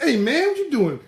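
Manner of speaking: high, loud taunting; mocking kids. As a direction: needle Derek about his stock, pitched high. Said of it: "the kids jump on Air, Derek".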